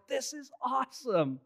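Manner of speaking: starry-eyed preteen calling out over the concert noise; awestruck kid. Retold or imitated. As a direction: gush like a thrilled twelve-year-old. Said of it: "and I'm like".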